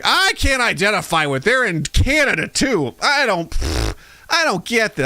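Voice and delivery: high, mocking whine; mocking whiny viewer. Mocking a fan who can't relate, high whine. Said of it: "And like".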